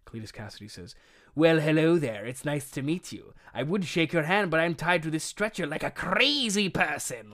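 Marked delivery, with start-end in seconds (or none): 1.3-7.3 hammed-up crazy killer greeting; Joker-like madman. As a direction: savour the words like a deranged clown. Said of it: "like he's like the Joker".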